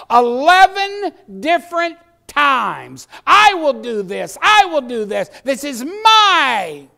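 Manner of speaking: loud, high, hammering each phrase; impassioned pulpit shouting; full-force preaching. Real, not acted. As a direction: drive the point home with slow, stretched stresses.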